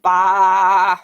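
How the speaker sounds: loud, held-out bleat; sheep baa. Pretend. Showing children what sheep say, loud playful bleat. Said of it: "They say".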